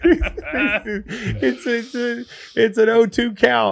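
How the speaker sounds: high, laughing play-by-play. Real, not acted. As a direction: giggle through it. Laughing baseball joke.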